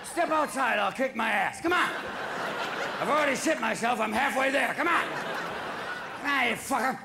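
hammed-up bar-fight challenge; belligerent drunk. Acted drunk challenging someone to fight.

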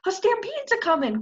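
high, loud urgent chorus; chorused alarm. Acted. Three animals warn the meadowlark together. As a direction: call it out together. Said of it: "chorused the trio".

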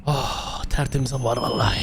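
long breathy exhale into the words; contented sigh. Pretend. Player stepping out into fresh air, contented sigh.

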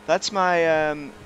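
normal talking voice

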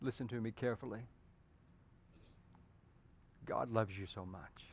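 quiet pastoral comfort; tender appeal. Acted. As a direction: draw them in gently. Sudden soft tender appeal.

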